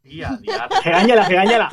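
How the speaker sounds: laughing while teasing; egging them on through laughter. Real, not acted.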